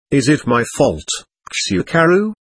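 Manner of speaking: plain reading voice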